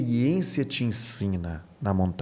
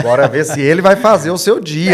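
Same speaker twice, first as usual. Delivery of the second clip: louder, laughing teasing jab; laughing tease; 5 semitones higher, 11 dB louder than usual. Real, not acted. Pastor teasing the host through laughter, louder.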